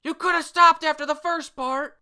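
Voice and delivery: very high, loud comic outburst; over-the-top high-pitched shout; indignant yell. Acted. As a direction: yell it in exasperated protest. Acted outburst in manga dialogue.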